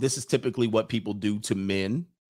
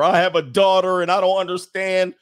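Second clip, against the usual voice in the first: higher, louder whiny plea; mock upset parent; 6 semitones higher, 5 dB louder than usual. Imitating a father invoking his daughter, mock plea. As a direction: parody a parent pleading. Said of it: "they're gonna bring in the daughter".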